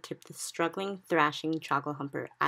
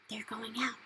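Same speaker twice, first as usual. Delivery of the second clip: hushed, breathy whisper of wonder; 5 semitones higher, 10 dB quieter than usual. A narrator's reading. Sophie whispers to the giant, watching bubbles leave, hushed. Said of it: "Sophie whispered".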